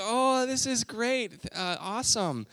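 put-on high, slow forced cheer of a little girl; fake happy girl. Daughter pretending to like the wrong doll, high forced cheer. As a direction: put on a brave happy face, slowly. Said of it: "trying to put on a happy face".

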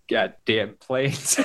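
very slow, laughing mock gripe; drawn-out grumble. Retelling the actor's plane line to friends, slow laughing drawl. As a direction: stretch "goddamn planes" out lazily through a laugh. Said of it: "he's like".

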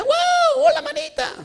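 mock shouted greeting to a friend, shrieking with joy; gushing shout. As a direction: call out across the street in over-the-top delight. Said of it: "Hasta te grita".

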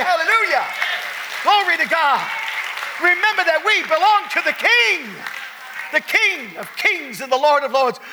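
loud, high, ringing preacher call; full-throated revival preaching; preaching at full force. Acted. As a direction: lift the church to its feet. Preaching climax.

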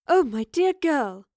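high, drawn-out exclamation; ringing cry of concern. Acted. Woman finding a fallen girl, ringing out in concern. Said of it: "a familiar voice rang out".

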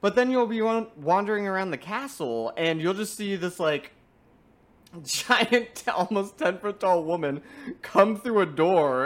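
ordinary delivery

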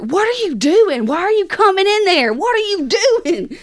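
high, insistent mock demand; exasperated questioning. Confronting Dad afterwards, retold exasperation.

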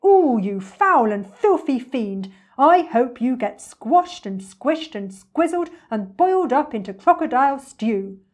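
loud, drawn-out cursing; indignant, swooping outburst; outraged elephant. Acted. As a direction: relish every insult as you curse.

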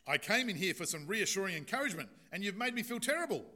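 loud, high, fast indignant rant; outraged patient. Acted. Offended patient rails at his doctor, loud indignant outrage. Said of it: "he says".

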